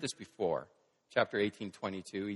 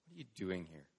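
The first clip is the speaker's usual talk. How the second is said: low, soft, near-whispered question; hushed question; 4 semitones lower, 10 dB quieter, faster than usual. Acted. Preacher putting God's question to each listener, hushed. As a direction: ask it quietly, letting it settle.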